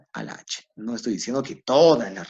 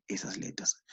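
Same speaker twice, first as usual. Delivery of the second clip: whisper; 13 dB quieter than usual. Real, not acted. Teacher drops voice mid-explanation.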